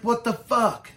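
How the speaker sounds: loud, high outraged curse. Retold or imitated. Kicking the window at his snitching friend, loud outrage. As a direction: yell it through the window at the traitor.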